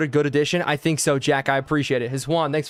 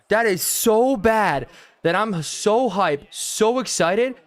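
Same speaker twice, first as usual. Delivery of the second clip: ironic hype; 5 semitones higher, much slower than usual. Real, not acted. Reacting to bad play.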